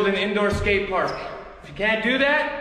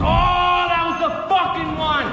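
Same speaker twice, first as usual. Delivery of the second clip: pained yell; 9 semitones higher than usual. Real, not acted. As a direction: yelp it out as the board hits you. Hurts himself on skateboard.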